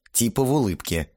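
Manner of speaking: ordinary delivery, nothing marked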